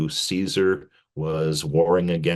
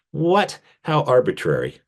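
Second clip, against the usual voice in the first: mock surprise; 7 semitones higher than usual. Mock surprise at Saint Crispin.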